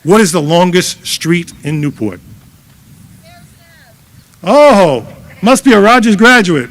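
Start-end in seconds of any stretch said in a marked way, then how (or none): 4.4-6.7 excited. Real, not acted. Reacts to a correct trivia answer.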